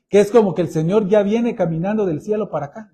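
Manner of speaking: usual speaking voice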